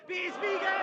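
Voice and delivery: loud shouted jab; barked battle-rap taunt. Acted. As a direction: shout the insult straight at him. Rapper hurls insult at opponent.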